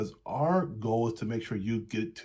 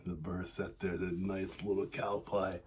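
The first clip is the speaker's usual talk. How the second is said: ordinary talk, nothing marked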